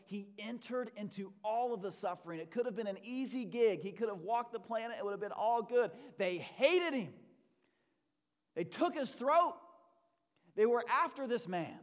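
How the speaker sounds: loud, driving sermon delivery; impassioned preaching. Real, not acted. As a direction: preach it with rising force. Preaches at full force.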